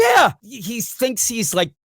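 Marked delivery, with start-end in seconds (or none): 0.0-0.3 a sharp, high, loud yeah; sudden shout. Real, not acted. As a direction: blurt it out in a burst of outrage. Mock outrage at the Industrial Revolution.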